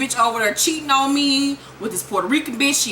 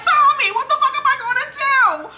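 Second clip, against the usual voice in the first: much higher, louder frantic mother; frantic mom; 4 dB louder than usual. Retold or imitated. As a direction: shriek it like a coked-out mom panicking. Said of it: "She's like".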